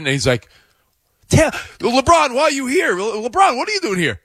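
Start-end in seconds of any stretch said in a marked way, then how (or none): 1.3-4.2 high-pitched, flustered surprise; startled wedding guest. Acted. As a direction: squeal it at an unexpected famous guest. Acted wedding guest surprised by LeBron.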